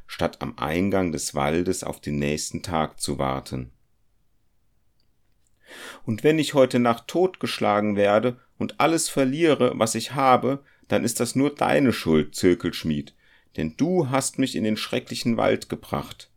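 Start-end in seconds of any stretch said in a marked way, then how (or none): 6.1-16.3 put-on higher, anxious young man blaming his companion; fearful young goldsmith. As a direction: plead and blame, pitched up and tense. Felix, the goldsmith, voiced in the story. (ängstlicher junger Goldschmied, höher und vorwurfsvoll)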